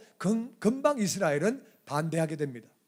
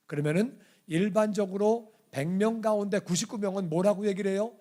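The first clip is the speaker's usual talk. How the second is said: ordinary talk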